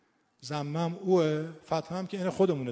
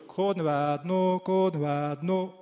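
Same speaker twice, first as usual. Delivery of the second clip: very slow Kermani chant with long vowels; Kermani accent; much slower than usual. Shown as a demonstration. Teacher summing up the operator's call, drawn-out Kermani accent. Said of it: "پس اینطوری شد".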